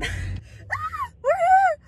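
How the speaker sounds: high squealing shouts of nervous excitement; excited squeal. Real, not acted. Pulling up to her date's place, squealing with nervous excitement. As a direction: shriek it out, laughing, too excited to hold back.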